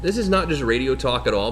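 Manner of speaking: normal talking voice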